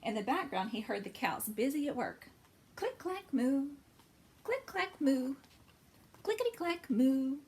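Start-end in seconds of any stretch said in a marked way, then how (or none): 2.8-7.4 sing-song clickety clack and moo; typewriter and moo sounds. Acted. Typing cows sound refrain.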